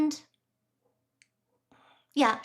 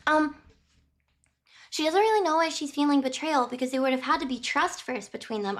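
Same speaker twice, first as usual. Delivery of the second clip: ordinary talk, nothing marked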